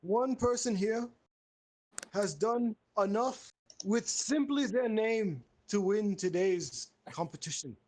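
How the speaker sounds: high, slow ceremonial proclamation; grand pompous master. Acted. Host awarding Alex first place, mock-grand proclamation.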